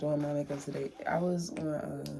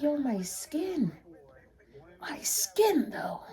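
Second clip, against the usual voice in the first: gushing at her own skin; 8 semitones higher, 4 dB louder, much slower than usual. Retold or imitated. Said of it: "I was like".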